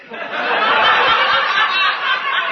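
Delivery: real high laugh; loud high laugh. Real, not acted. Laughing at his own story.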